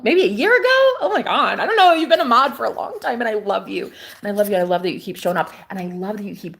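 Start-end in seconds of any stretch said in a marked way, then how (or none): 0.0-3.2 excited. Real, not acted. Excited chatter with a mod.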